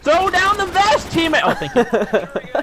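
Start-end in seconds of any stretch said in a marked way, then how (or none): 0.0-1.4 high, drawn-out call to a teammate. Real, not acted. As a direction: stretch it out high, demanding supplies. Calling to teammate for supplies.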